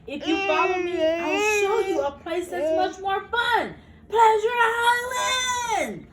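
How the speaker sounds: high, loud sales pitch, stretching Pleasure Island; excited enticing call. Acted. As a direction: lure him in with a big show. Fox luring Pinocchio away.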